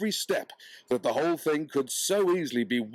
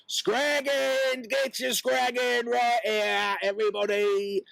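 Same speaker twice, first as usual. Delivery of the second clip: market hawker's shout; 11 semitones higher, slower than usual. Acted. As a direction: bawl it out to the market crowd. Hawking meat at her stall.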